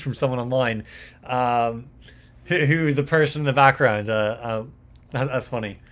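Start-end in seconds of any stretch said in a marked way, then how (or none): none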